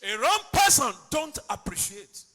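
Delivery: loud, emphatic sermon delivery; preaching at full force. Acted. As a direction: preach it out loud, stretching key words.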